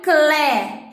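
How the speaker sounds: "clé" isolated, loud and drawn out; stressed syllable. Shown as a demonstration. Teacher points out the tonic syllable, "clé" loud. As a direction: hit "clé" hard and hold it. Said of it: "é a mais alta de todas".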